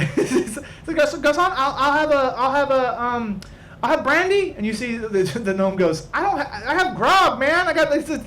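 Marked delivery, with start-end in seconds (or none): 0.1-4.5 high, dithering order; fussy high voice. Acted. Whitnan orders a drink. 6.1-8.2 gnome barkeep. Acted.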